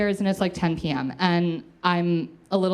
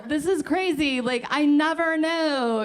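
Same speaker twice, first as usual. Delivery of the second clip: higher, slower, wide-eyed wonder; mock amazement; 8 semitones higher, slower than usual. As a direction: stretch it out in a high, dazed voice. Acting out her own shock.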